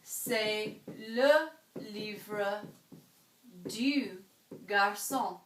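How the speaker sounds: "C'est le livre du garçon" said slowly in French, each word set apart; French pronunciation. Shown as a demonstration. Teacher modelling French words slowly for students. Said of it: "it would be".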